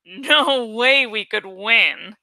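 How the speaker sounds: plain reading voice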